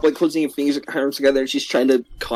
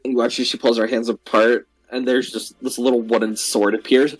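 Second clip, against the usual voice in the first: ordinary delivery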